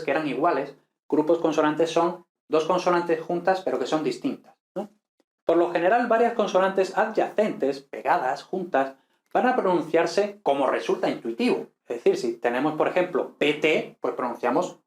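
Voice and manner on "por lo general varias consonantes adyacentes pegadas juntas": ordinary delivery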